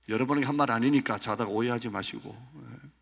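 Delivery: ordinary talk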